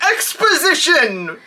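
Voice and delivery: high slow hammed-up shout; mock Scrooge. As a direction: ham up the old miser. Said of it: "Scrooge is just like".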